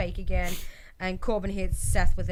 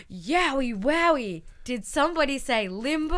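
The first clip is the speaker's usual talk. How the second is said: high, stretched-out playful catchphrase; mock kids' show host; 6 semitones higher than usual. Host imitates Bray interrupting Abby, exaggerated kids' show cheer. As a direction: ham it up for a pretend audience of children.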